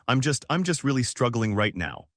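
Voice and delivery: usual speaking voice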